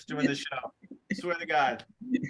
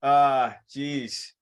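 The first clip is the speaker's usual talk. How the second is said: ordinary talk, nothing marked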